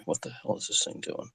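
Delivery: under-the-breath whisper; whispered mutter. Real, not acted. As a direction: grumble it to yourself in a whisper. Muttering at a glitching computer.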